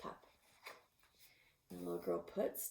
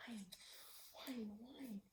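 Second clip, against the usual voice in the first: soft whining pup; 12 dB quieter, slower than usual. Acted. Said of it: "and the wolf pup says".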